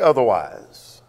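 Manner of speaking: "otherwise" said low and drawn out; slow right down to underline "otherwise"; stress on otherwise. Real, not acted. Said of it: "I emphasize the word otherwise".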